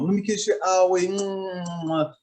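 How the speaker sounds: put-on high, very slow, cooing baby talk. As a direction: soothe a crying toddler, drawn out. Father coddling a fallen daughter.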